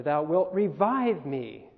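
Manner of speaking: slow, with the weight on "revive"; stress on revive. Shown as a demonstration. Teacher's third take, slow with stress on "revive". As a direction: draw it out and press on "revive".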